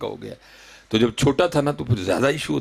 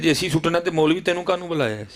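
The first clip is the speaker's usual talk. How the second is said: mildly indignant, higher-pitched quote; retold angry retort; 6 semitones higher than usual. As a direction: imitate an angry son challenging the cleric. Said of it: "بڑے غصے میں کہتا ہے".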